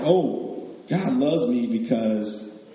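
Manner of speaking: lightly voiced conceited churchgoer; smug self-praise. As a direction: ham up smug self-love with a laugh. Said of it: "You say like".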